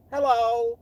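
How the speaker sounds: high, loud campy hello; mock Miss Thing greeting. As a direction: impersonate the queen saying hello. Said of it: "Miss Thing came and waddled up to me and he was like".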